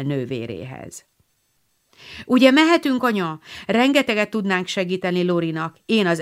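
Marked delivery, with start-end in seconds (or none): 2.2-3.4 put-on higher, louder eager girl; eager plea. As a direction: beg mother to let them go. Meg begging mother. (lelkes könyörgés)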